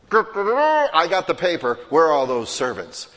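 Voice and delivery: self-important boaster. Retold or imitated. The preacher mocks a churchgoer who brags about picking up paper, loud self-important complaint. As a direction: trumpet your good deed like a show-off. Mocking proud self-server.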